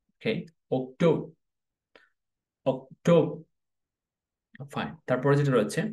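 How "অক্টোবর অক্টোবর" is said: অক্টোবর in syllables, "ok, TO-bo"; repeated teacher's model of r-less অক্টোবর; correct English pronunciation. Shown as a demonstration. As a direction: stress "to" in অক্টোবর, no final r. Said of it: "এর সঠিক উচ্চারণ হচ্ছে".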